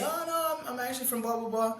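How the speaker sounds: high-pitched imitation of a girl; mimicked girl's reply. Coach plays the girl correcting his guess, mock high voice. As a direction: go way up high, drawing out the polite denial.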